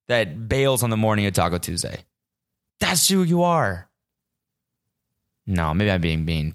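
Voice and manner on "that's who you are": higher, drawn-out accusation; pissed off. Real, not acted. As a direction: pass judgement slowly, indignant. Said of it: "I'm pissed".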